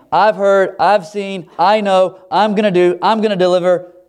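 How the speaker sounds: building excitement. Acted. Preacher voicing God to the congregation, excited and emphatic. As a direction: pile up God's promises with rising energy. God's rising list of promises.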